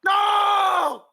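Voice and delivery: high, loud anguished No; yell to the heavens. Acted. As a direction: scream it skyward on your knees. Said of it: "yell towards the heavens".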